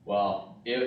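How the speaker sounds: ordinary talk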